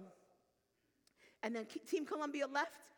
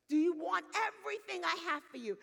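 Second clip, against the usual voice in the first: loud, raised preaching cry; impassioned appeal; 7 semitones higher, 8 dB louder than usual. Acted. God asks the congregation again at climax, impassioned. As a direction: build to a full-force, high-pitched challenge.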